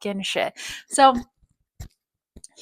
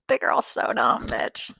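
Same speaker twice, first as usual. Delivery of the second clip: laughing under breath; 10 semitones lower, slower than usual. Real, not acted.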